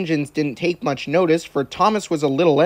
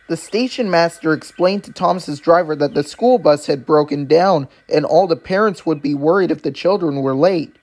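ordinary delivery

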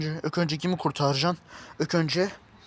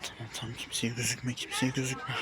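much softer, low self-warning; hushed to self; 4 semitones lower, 11 dB quieter than usual. Acted. Hiding, softly warning himself not to be seen. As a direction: hush yourself so nobody hears.

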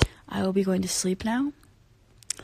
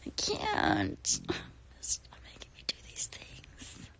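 soft unvoiced whisper; 10 dB quieter, slower than usual. Real, not acted. Whispering to fans on a late-night livestream. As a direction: hush it like a secret. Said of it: "I don't know why I'm whispering".

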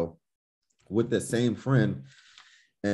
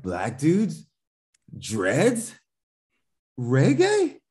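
rising higher word by word, then a slow stunned question; retold disbelief; 7 semitones higher, much slower than usual. Telling a friend how the Bad Brains video hit him, incredulous high-pitched retelling.

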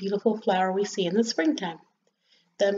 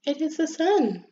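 higher, cheerful little sun; cheery little voice; 6 semitones higher than usual. Acted. Sun answers the rosebud at the door, cheerily. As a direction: announce yourself cheerily in a raised pitch. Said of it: "said a cheery little voice".

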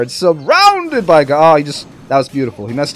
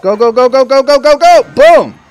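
excited cheering; 5 semitones higher, 8 dB louder than usual. Real, not acted. Cheering the push on.

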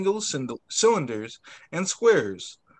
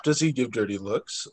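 ordinary delivery

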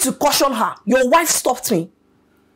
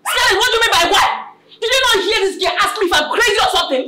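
fast, high, louder indignation; indignant rant; 8 semitones higher, 3 dB louder, much faster than usual. Acted. Stepmother ranting at the insolent girl, indignant. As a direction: pile the outrage on fast.

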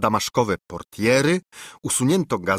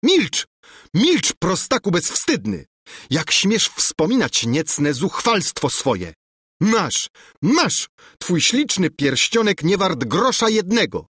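much higher, loud furious screech; a princess shrieking at her cousin to shut up; screaming furiously; 9 semitones higher, 4 dB louder than usual. A narrator's reading. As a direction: scream at him in a rage. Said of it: "wrzeszcząc".

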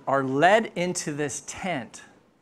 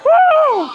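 loud high-pitched "woo" of delight; shrieked cheer over the food; excited whoop; 13 dB louder than usual. Acted. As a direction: whoop at the top of the voice. Acted delight at bread on table.

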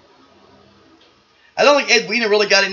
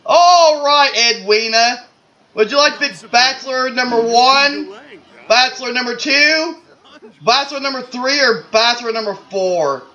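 projected host patter with a big opening; game-show host; 8 semitones higher, 5 dB louder than usual. Acted. Host offering Edwina the bachelors, showy presenter delivery.

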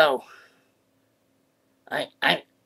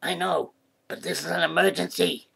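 ordinary delivery

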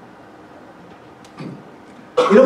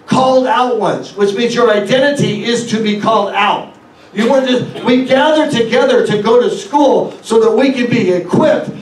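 raised, driving pulpit voice; higher, louder sermon cadence building; full-force preaching; 7 semitones higher, 6 dB louder than usual. Acted. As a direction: preach it out loud to the congregation.

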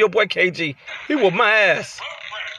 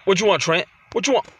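normal talking voice

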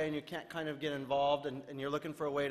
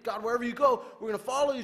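higher, louder eager pledge; eager disciples; 7 semitones higher, 6 dB louder, faster than usual. Retold or imitated. Disciples pledge to follow Jesus, higher eager voice. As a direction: promise it with zeal.

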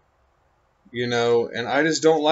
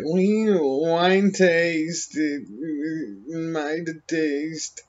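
mock reply; 4 semitones higher, much slower than usual. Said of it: "I was like".

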